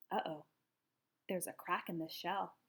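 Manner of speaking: soft, low uh-oh; dismayed. A narrator's reading. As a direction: notice the trouble quietly. Noticing a cracked egg.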